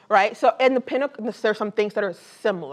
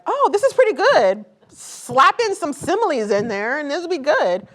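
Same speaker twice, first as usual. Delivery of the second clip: higher, pleased mock self-congratulation; inner writer voice; 7 semitones higher than usual. Author voicing the writer in her head, pleased and higher-pitched. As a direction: play your own smug inner writer. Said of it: "the writer in me says".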